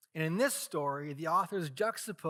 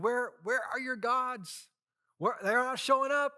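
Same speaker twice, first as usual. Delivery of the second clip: higher, mocking jeer; taunting; 8 semitones higher, 3 dB louder than usual. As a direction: poke fun at the rivals across the mountain. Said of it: "to taunt them and, and tease them".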